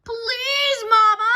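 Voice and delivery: high, loud pleading shout; shouted plea. Acted. Brother begging mother to start the car, loud pleading shout. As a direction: beg her loudly, high. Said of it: "shouted Brother".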